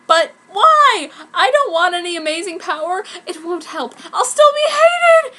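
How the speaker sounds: high, loud tearful cry; beginning to cry. A narrator's reading. Heroine refusing her powers, crying out. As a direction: wail it on the edge of tears. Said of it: "beginning to cry".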